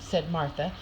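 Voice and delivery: usual speaking voice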